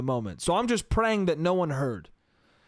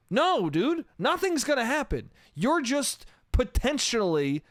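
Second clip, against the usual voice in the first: higher-pitched, incredulous scolding; fed-up, high-pitched telling-off; exasperated rant; 5 semitones higher than usual. Acted. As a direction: fire questions at someone who should know better. Acted exasperated rant at aunt.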